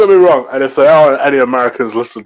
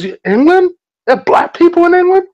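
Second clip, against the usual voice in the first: much higher, imitating an amazed tout; mock astonishment; 4 dB louder than usual. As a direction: play the stunned stranger, pitch way up. Imitating Vegas tout's astonishment.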